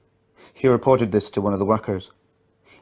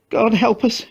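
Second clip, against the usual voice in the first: much higher, anguished plea; desperate prayer; 13 semitones higher, slower than usual. A narrator's reading. Mother prays in anguish, much higher plea. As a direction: pray in despair. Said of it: "she said".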